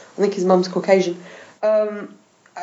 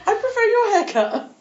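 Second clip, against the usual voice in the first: higher, put-on compliment voice; lightly hammed-up praise; mock flattering; 13 semitones higher than usual. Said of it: "was like".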